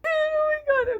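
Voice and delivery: high, loud exclamation; exclaiming. Real, not acted. Vlogger exclaiming at her own rant. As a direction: squeal it out.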